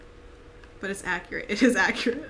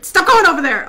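loud, urgent command; scolding shout; 5 semitones higher, 11 dB louder than usual. Real, not acted. Player to straying gnomes, scolding shout.

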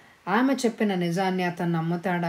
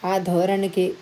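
ordinary delivery

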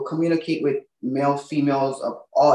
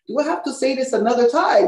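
exasperated; 7 semitones higher than usual. Retold or imitated. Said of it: "and I'm like".

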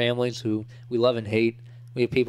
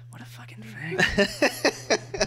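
mock whisper; 8 semitones higher, much slower than usual.

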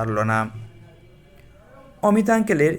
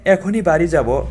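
surprised; 4 semitones higher, 6 dB louder than usual. Acted. Husband startled by her demand.

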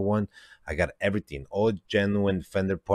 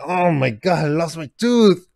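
freaking out; 10 semitones higher, 7 dB louder than usual. Retold or imitated. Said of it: "Freaking out".

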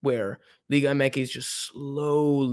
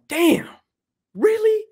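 high, louder burst of surprise; mock disbelief; 5 dB louder, slower than usual. Fan hearing of record attendance, high-pitched disbelief. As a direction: jump up high in astonishment. Acting out reaction to attendance news.

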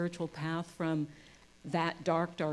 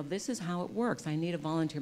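usual speaking voice

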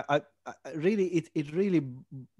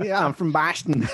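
imitated Boston accent, pitched up; mock Boston local, accent raised in pitch; 4 semitones higher, 4 dB louder than usual. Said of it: "I actually do have a Boston accent".

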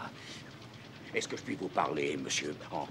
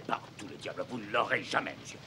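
ordinary delivery

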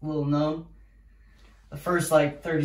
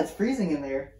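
ordinary delivery

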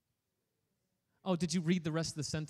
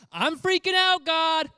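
much higher, much louder, mock panic; panicked disciple; 13 semitones higher, 13 dB louder, slower than usual. Preacher voicing a panicked disciple to Jesus, hammed-up panic. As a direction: cry out like a terrified disciple. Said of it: "disciple voiced in retelling".

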